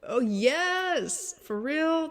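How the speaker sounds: high and loud, excited; excited exclamation. Real, not acted. Vlogger ranting to her viewers, high excited outburst. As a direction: burst out with it.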